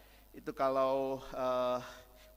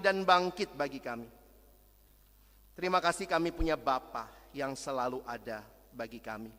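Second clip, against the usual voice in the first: earnest congregational prayer voice; leading the church in closing prayer; 2 semitones higher, 4 dB louder than usual. Acted. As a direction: pray aloud for the congregation.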